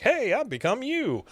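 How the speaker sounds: high, swooping cheery "Hey"; upbeat version. Retold or imitated. Hank showing Luann how to say it, upbeat and high. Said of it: "could have said it more like".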